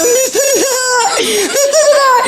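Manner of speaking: very high, slow drawn-out cries; mother cries out in amazement; crying out amazed. Retold or imitated. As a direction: wail it in astonishment. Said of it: "이러고 막 울고 있는데".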